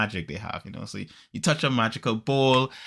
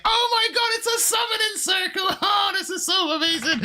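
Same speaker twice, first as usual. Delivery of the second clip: very high-pitched gushing squeal; hammed-up geek girl losing it with delight; fangirl squeal. Secret weeb seeing a summoning circle, squealing in mock ecstasy. Proper girl revealed as excited nerd.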